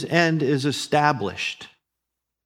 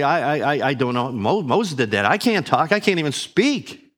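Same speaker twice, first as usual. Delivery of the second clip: stammering excuse; 3 semitones higher, 6 dB louder than usual. Pretend. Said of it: "you might say".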